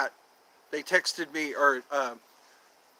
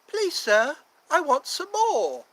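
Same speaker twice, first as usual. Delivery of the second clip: put-on much higher, timid boy begging for more; pleading orphan boy; 10 semitones higher, slower than usual. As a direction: ask humbly, like a hungry child. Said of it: "Oliver Twist".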